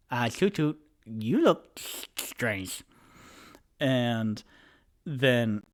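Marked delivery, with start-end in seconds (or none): 0.1-2.8 lightly put-on voice, the end slowed right down; a hint of a dopey friend, stretching out the words; Tipsy's puzzled voice. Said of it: "He goes".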